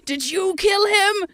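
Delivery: high, slow accusing cat voice. Retold or imitated. Bully cat accusing his brother, high and slow. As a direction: draw it out like a suspicious bully cat. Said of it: "'Cause he was like".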